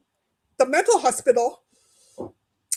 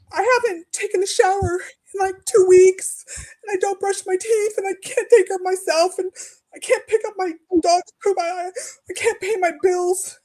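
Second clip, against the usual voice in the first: higher, with teary breaks and a catch of a laugh; listing everything she can't do, close to sobbing; retold tearful plea; 6 semitones higher, 3 dB louder than usual. Begging the emergency line for help, voice breaking.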